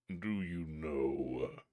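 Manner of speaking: low, slow, menacing spirit; awful voice. Acted. Terrible spirit threatening the boy, low and slow. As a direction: loom over the boy and threaten. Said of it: "he cried in an awful voice".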